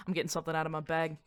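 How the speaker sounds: usual speaking voice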